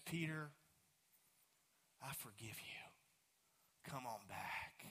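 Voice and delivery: slow, hushed and low, like a gentle call home; softly forgive him, lingering on each word; Jesus gently inviting Peter back, very soft and slow; tender forgiveness. Acted.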